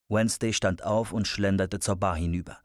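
ordinary delivery

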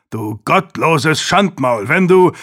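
loud, rising fury, cut off mid-threat; angry outburst. A narrator's reading. Uncle flaring up at his blasphemous nephew, furious. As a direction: flare up and start a threat. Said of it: "fuhr Master Durham auf".

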